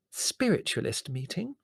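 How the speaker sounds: high, rising voiced question; puzzled echo. A narrator's reading. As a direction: repeat it aloud in disbelief. Mother echoes the secret aloud.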